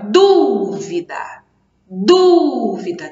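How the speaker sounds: dúvida with a long, punched DÚ; slow demo of dúvida, DÚ strongest; stress on first syllable. Shown as a demonstration. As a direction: hold the DÚ, let vida drop away. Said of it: "a sílaba mais forte tá aqui".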